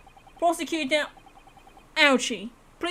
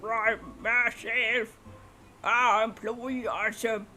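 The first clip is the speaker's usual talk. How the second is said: put-on slow, lowered old-man drawl; slow old collector; much slower than usual. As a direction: ramble slowly like an old gent.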